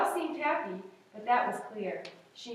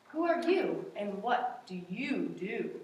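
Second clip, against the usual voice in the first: slow kind zookeeper; 3 semitones lower than usual. Acted. Zookeeper asks.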